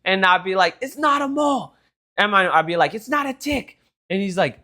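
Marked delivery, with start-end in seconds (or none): 0.8-1.7 high-pitched exasperated shout; imitated outraged protest. Acted quarrel with trolling dad. 3.0-3.8 high-pitched insistent denial; imitated exasperated protest. Acted quarrel with trolling dad.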